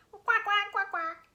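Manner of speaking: high, loud imitated quacks; ducks quacking. Ducks answer the mouse's hello, loud high quacking. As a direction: quack back noisily like ducks. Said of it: "what do ducks say?".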